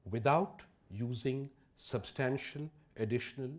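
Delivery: slow, deliberate delivery with pauses between phrases; measured and unhurried, as if for a sceptical room; very slowly. Shown as a demonstration. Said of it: "say this very slowly".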